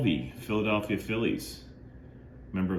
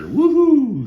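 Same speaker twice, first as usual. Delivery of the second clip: much higher, much louder whoop; excited woohoo; 12 dB louder than usual. Real, not acted. Collector cheering a blue Sharpie autograph, excited high loud whoop. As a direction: cheer like you hit the jackpot. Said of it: "I'm saying I'm woohoo because he got me the blue Sharpie".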